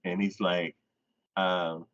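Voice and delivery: usual speaking voice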